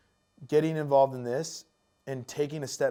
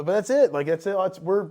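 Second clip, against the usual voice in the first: normal talking voice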